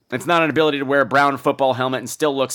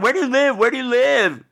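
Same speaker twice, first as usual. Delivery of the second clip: aggressive stranger; 11 semitones higher than usual. Retold or imitated. Imitating the man who grabbed her, high mock yell. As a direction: bark it like a threatening stranger. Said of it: "screaming about".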